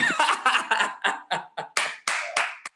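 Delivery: long, trailing burst of laughter; hearty laugh. Real, not acted. Teacher laughing at parents' chat answers, amused.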